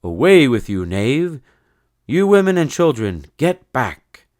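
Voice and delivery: loud, high battle command; knightly cry. Acted. Sir Cedric ordering the women and children back, shouting. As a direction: bellow orders at the foe. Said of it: "Sir Cedric the Brave cried".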